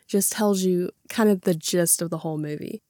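ordinary delivery

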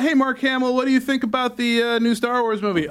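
imagined interviewer. Acted. Host acting a reporter questioning Mark Hamill, mock interviewer voice. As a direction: put on an eager interviewer. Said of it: "this is the conversation I'm assuming happened".

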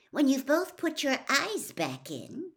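high, loud brisk remark; a fed-up girl snaps her friends back to attention. Acted. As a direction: cut the boys off sharply. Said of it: "said Hermione briskly".

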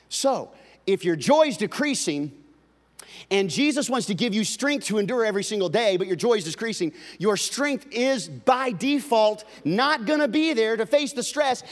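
loud, high, driving sermon delivery; a pastor pressing the point on the congregation; full-force preaching. Acted. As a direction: build the warning to a peak.

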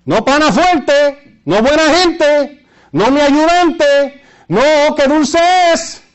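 high, slow sarcastic list; mock each sweet name, high and drawn out; mocking sing-song. Mocking death as a friend.

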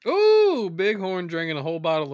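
high, loud ooh; excited ooh. Real, not acted. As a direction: squeal with delight. Reacting to a card.

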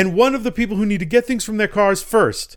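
high, loud hammed-up army officer giving orders; mock military guy. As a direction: bark orders in a squeaky mock soldier voice. Military guy orders group split.

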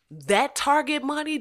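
ordinary talk

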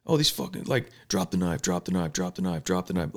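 a cop stuck repeating the same order, muttered low; mock panicked cop. Mimicking a stuck cop, repeating the order low and soft.

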